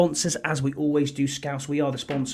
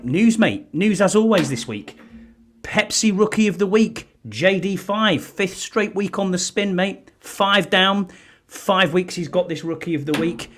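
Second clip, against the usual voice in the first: higher and louder, pumped about the news; excited news; 8 semitones higher, 5 dB louder than usual. Real, not acted. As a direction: sell the big news with energy. Host launches into the week's news.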